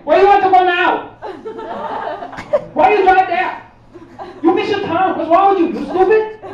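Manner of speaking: high, loud mock Chinese accent; a rude GPS voice in a put-on Chinese accent, pitched high. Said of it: "it's like".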